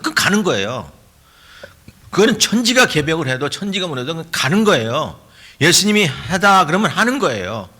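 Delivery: high, ringing certainty from the pulpit; impassioned preacher, pitch pushed up; forceful preaching. Real, not acted. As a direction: declare it as beyond all question.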